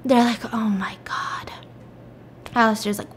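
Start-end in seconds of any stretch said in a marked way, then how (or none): 0.5-1.5 soft whispered aside; exasperated whisper. Retold or imitated. Police reacting to her impostor claim, exasperated whisper. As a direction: whisper it under your breath.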